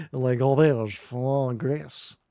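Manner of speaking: slow, hammed-up French accent; mock French reading. Shown as a demonstration. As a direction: draw it out in a bad French accent. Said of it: "my terrible reading of that French word".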